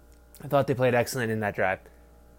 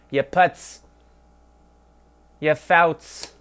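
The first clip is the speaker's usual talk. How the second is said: mock Yiddish insult; 3 semitones higher, 3 dB louder, much slower than usual. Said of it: "it sounds like Yiddish".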